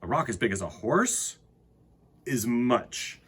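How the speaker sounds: put-on gentle read-aloud to children; storybook reading. Reads picture book aloud.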